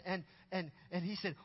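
ordinary delivery

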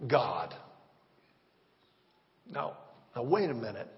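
ordinary talk, nothing marked

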